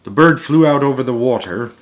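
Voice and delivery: ordinary delivery, nothing marked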